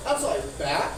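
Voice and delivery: ordinary delivery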